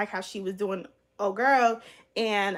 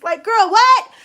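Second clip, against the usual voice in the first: stunned by a fan's request; loud disbelief; 9 semitones higher, 13 dB louder, slower than usual. Real, not acted. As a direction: shout what in total disbelief. Reacting to absurd fan comment.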